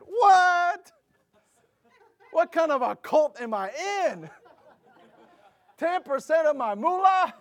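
high, loud shocked questioning; outraged disbelief. Retold or imitated. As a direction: react as if scandalized. Acted shock at pastor's answer.

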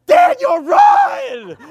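very high, full-volume panicked yell; panicked shout. Retold or imitated. Friend in a taxi warns his companion, panicked shout. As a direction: scream a warning to your friend, playing it up. Acting out friend yelling to flee.

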